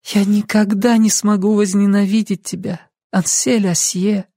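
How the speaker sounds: high, subdued voice; weary young woman. A narrator's reading. Lucy tired, answering Ansel's charge of hatred, weary. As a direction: answer wearily, slowing on the name. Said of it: "устало посмотрела на него".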